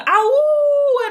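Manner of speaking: high loud howl; dog howl. Acted.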